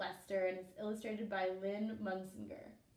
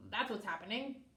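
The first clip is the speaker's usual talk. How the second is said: ordinary delivery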